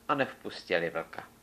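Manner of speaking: usual speaking voice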